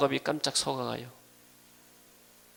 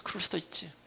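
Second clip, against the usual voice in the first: quiet, higher-pitched concession; accepting; 4 semitones higher, 9 dB quieter than usual. Acted. Joseph excusing his brothers, soft and accepting. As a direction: softly let the wrong pass. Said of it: "형들에 대해서".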